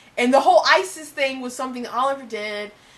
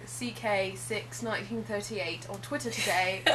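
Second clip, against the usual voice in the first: fake British accent; 5 semitones lower than usual.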